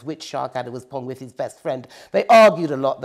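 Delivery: plain reading voice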